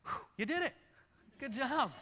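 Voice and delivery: high-pitched playful cheer; mock congratulation. Preacher to congregation after a long summary, mock congratulation. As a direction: cheer them as if they survived an ordeal.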